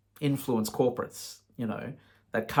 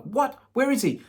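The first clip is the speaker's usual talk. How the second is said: alarmed; 13 semitones higher than usual. Retold or imitated. His alarmed reaction.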